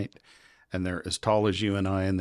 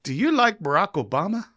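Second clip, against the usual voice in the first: much higher put-on officer voice; imitating the officer; 10 semitones higher, 3 dB louder than usual. Immigration officer teasing the American traveler, high put-on voice. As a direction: play the passport officer, pitched way up and sly. Officer poses loaded question.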